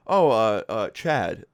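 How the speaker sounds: reluctant, halting reply with filler sounds; hesitant answer. Retold or imitated. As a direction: stall before giving up a name. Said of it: "didn't seem to be wanting to say his name".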